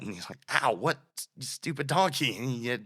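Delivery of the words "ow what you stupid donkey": annoyed Balaam. Retold or imitated. Balaam voiced in retold story.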